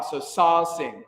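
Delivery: slow "So sal sing", sal halfway between a and ah; teacher models "sal" in between; vowel between a and ah. Shown as a demonstration. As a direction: stretch the a of "sal" between Midwestern a and ah. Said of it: "in between me, Midwestern, ah".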